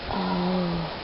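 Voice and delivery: usual speaking voice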